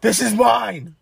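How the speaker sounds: high, loud mock scream; imitated scream. Host acting out Edge with the chair, screaming. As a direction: yell it out, imitating a wrestler. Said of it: "He screams".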